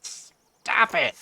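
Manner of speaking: mock-pained high whine of protest; exasperated plea. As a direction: beg them to stop in a high, strained voice. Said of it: "and I'm like".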